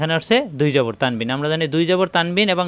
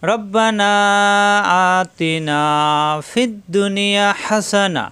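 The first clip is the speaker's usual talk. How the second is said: slow chanted dua, higher and drawn out; Quranic recitation; 4 semitones higher, much slower than usual. Shown as a demonstration. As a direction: recite it as a model for students. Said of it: "তাহলে কীভাবে পড়ব".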